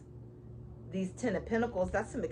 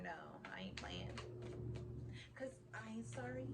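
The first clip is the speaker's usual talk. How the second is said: whisper; 14 dB quieter than usual. Real, not acted. Whispered denial.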